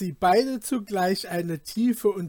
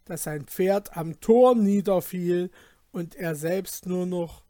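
ordinary delivery

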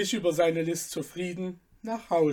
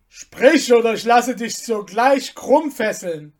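higher and loud, menacing; threatening general; 4 semitones higher, 8 dB louder than usual. Acted. General threatening Abner for the slave's whereabouts, loud.

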